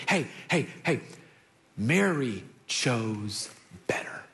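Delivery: low, slow and soft; gentle Jesus. Acted. Jesus gently correcting Martha, low and soft. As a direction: ease her down tenderly.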